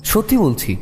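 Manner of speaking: ordinary delivery, nothing marked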